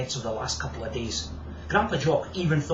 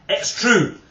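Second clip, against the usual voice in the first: proudly; 8 semitones higher, 7 dB louder than usual. Acted. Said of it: "confirmed George proudly".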